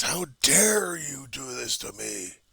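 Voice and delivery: high, slow, drawn-out cry of rage; anguished slow yell, pitched high; screaming at the universe. Acted. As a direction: hurl each word up at the sky, slowly. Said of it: "Scream and yell at the universe".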